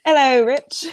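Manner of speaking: loud, playful Australian-sounding hello; Rebel Wilson. Pretend. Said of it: "You sounded like her".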